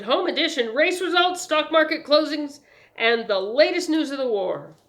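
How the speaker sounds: high, loud hawking of headlines; eager paper seller's pitch; newsboy's sales patter. A narrator's reading. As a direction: rattle off the wares like a newsboy. Newsboy reciting his wares.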